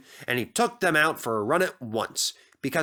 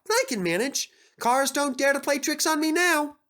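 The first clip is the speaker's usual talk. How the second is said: Percy's voice; 13 semitones higher than usual. Acted. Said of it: "he thought".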